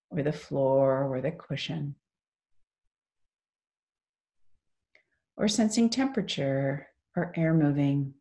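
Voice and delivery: guided meditation. Real, not acted. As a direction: lead them gently into stillness, phrase by phrase.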